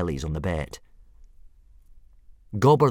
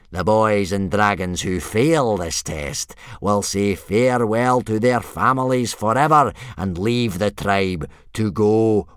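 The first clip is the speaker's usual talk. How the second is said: loud proclamation, raised pitch; grim bellow; 4 semitones higher, 8 dB louder than usual. A narrator's reading. Instructor warning boys of exile, bellowing grimly. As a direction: bellow it out, then slow for the threat. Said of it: "Gobber bared his teeth grimly".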